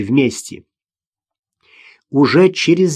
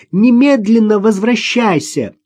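high, slow desperate order; near tears; 7 semitones higher, 4 dB louder than usual. A narrator's reading. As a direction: plead and order at once, pitched up. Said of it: "Ирина была готова расплакаться". (приказ на грани слёз)

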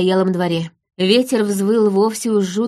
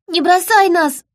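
very high, raised plea; pleading child's cry; 10 semitones higher, 4 dB louder than usual. A narrator's reading. Daughter begging her vanished father, high pleading cry. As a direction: beg at a high pitch into the storm.

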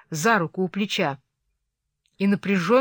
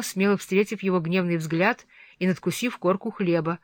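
usual speaking voice

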